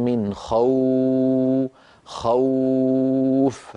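خوف held very long on the aw glide; drawn-out khawf. Shown as a demonstration. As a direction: linger on the waw of خوف. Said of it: "لما نقول مثلًا". (مد الواو في خوف طويلا)

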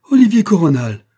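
high, very loud cry of a name; piercing cry. A narrator's reading. Heiress recognizing the inventor, piercing cry. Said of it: "un cri déchirant se fit entendre".

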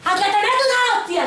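very high, loud yelled threat; furious threat. Retold or imitated. Woman threatens a harasser, yelling high and loud. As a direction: threaten to smack him.

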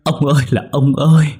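high, loud keening cry; anguished wail. A narrator's reading. Widow wailing at her husband's bedside, high and loud. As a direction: beg the dead man to wake, wailing. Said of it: "rồi lại gào lên".

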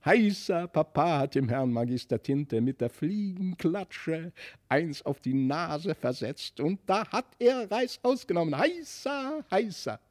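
put-on children shouting Heisa with glee; children cheering. A narrator's reading. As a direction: cheer like kids freed from a tyrant. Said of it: "jauchzten und jubelten und riefen".

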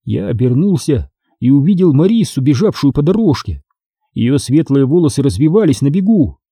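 ordinary delivery

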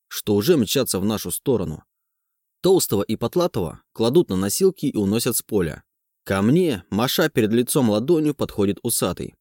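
usual speaking voice

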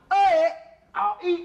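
a high, loud shouted "Oi"; loud call. Shown as a demonstration. Loud opening call while rehearsing the pantun with his partner. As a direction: belt the call out to start. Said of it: "Coba, coba".

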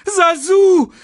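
very high, loud cry for help; scared scream. A narrator's reading. Simba screams to Sasu from the breaking branch. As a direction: scream the name in panic. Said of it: "schrie Simba".

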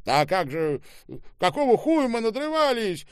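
high, loud protest; indignant exclamation. A narrator's reading. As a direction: cry out in exasperation. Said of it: "воскликнул Штаубе". (возмущённый громкий возглас)

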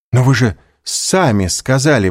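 высокое взволнованное возражение; возрази с жаром; восклицание. A narrator's reading. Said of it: "воскликнула Эжени Данглар". (взволнованное восклицание)

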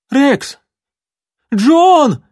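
screaming high and loud; desperate scream. A narrator's reading. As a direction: scream for help in terror. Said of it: "истошно закричал парнишка".

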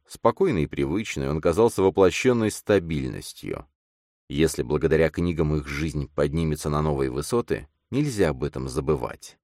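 ordinary delivery

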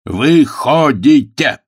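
loud, high, drawn-out command; emphatic order. A narrator's reading. Agnes throws Pilcher out of the game, ordering loudly. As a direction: order him out loudly, syllable by syllable.

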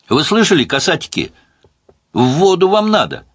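loud, high, taunting call; mocking shout. A narrator's reading. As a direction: jeer at them from the shore. Gunwoman taunting men in water.

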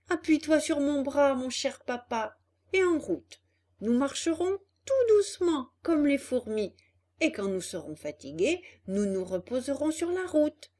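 caring son, slightly lifted storybook voice; a hint of an eager puppet boy; lightly voiced Pinocchio. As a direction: gently urge a weary father along. Pinocchio encourages his weary father.

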